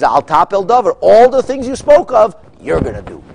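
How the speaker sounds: a king furiously dressing down his minister; loud and high, hammering each word at Haman; loud scolding king. Acted. King repeats command to Haman.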